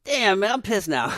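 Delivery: loud, high indignation; hammed-up, comic outrage; mock bruised ego. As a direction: act out a delayed flare of offense. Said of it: "then the ego gets bruised".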